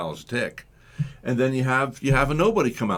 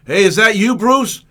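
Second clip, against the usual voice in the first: calling out; 7 semitones higher, 9 dB louder than usual. Acted. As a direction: call out higher and much louder. Calling out in empty theater.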